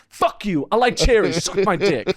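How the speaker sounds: high-pitched taunting jeer; trash talk. Retold or imitated. Talking shit to the Irish guy from stage, taunting. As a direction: heckle him from the stage. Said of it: "talking shit from the stage".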